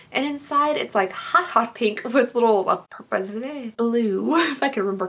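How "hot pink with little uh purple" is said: ordinary talk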